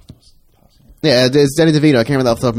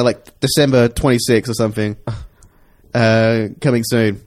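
ordinary talk

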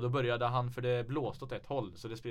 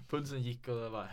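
ordinary delivery

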